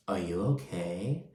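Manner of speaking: low, very soft, hollow check-in; empty concern. Retold or imitated. Lisa's empty check-in on hurt Whitney, low hollow concern. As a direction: imitate going through the motions of caring. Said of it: "not just some fucking empty ass".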